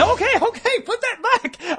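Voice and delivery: high, laughing okay okay; alarmed placating. Acted. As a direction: back off in mock alarm.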